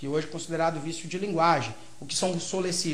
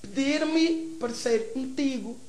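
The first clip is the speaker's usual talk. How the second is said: European Portuguese, slow and higher; Portuguese accent; 6 semitones higher than usual. Shown as a demonstration. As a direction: say "Pedir-me" the Lisbon way, unstressed vowels swallowed. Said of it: "pra eles, soa melhor falar".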